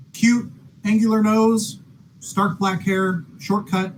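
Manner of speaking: normal talking voice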